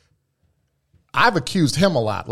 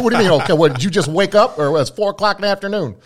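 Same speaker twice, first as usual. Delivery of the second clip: higher and louder, imitating his own irritated rant; mock exasperation; 7 semitones higher, 4 dB louder than usual. Ranting at Dan on the phone, higher, louder mock exasperation. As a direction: needle him like a cranky complainer.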